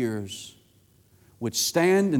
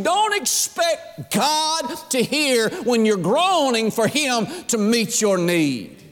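full-force preaching; 8 semitones higher than usual. Acted. Preacher warning a woman not to expect God's hearing, impassioned. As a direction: pitch climbing to a high, stretched cry.

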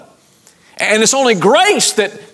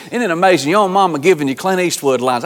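ordinary delivery

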